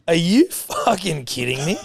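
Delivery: slow, high disbelieving; amazed disbelief. Retold or imitated. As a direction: stretch out the shock. Men amazed.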